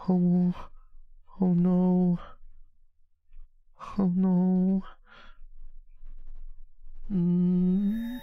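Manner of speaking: high, drawn-out moans of fake fear; hammed-up high whimpering; pretend scared. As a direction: moan as if frightened, unconvincingly. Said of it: "I think I sold that one".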